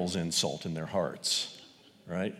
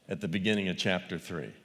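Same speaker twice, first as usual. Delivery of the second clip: ordinary talk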